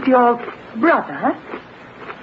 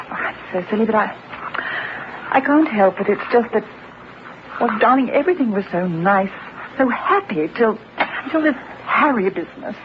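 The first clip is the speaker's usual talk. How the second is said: upset, halting confession; 11 semitones higher than usual. Acted. Wife admitting worry to husband, upset and halting. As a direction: falter through it, pausing between phrases. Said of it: "you're obviously upset about it".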